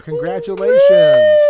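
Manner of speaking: high, loud winner announcement; excited shout. Acted. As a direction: cheer it out like a host.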